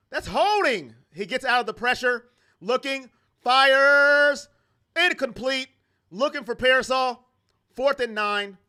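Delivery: rising broadcaster energy on the throw; live game call building up; excited play-by-play. Acted.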